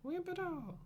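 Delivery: squeaky high shouted name; hammed-up high yelp; mock samurai cry. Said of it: "a Wimplo kinda samurai".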